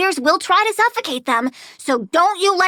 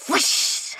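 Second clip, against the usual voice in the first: breathy whoosh; 4 dB quieter than usual. Acted. Mimicking a blast.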